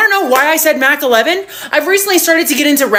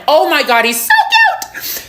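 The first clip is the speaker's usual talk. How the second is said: gushing squeal. Acted. Gushing over a new match.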